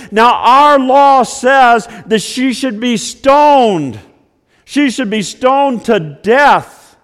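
loud, high, slow preaching; fervent preaching, loud and slow; preaching retelling. Acted. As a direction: hammer home the accusation. Preaching voice continues.

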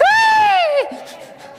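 a shrill, very high scream imitated; a hammed-up high scream, imitating a friend; girly scream. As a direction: shriek like a startled girl. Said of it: "this is what he did".

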